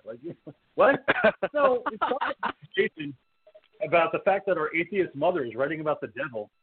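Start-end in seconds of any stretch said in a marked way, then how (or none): 0.1-3.2 incredulous laugh, pitch jumping up; laughing disbelief. Real, not acted. Exasperated laugh at Michael's reaction.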